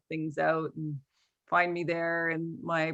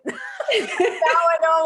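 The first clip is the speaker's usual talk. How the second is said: laughing; 11 semitones higher, 8 dB louder, much slower than usual. Real, not acted. Laughing at own confusion.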